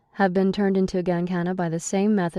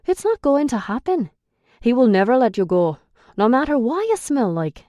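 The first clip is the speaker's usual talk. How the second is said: raised, scornful Irish male voice; Declan, Irish brogue; 6 semitones higher, 6 dB louder than usual. A narrator's reading. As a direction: sneer at her in a higher Irish lilt.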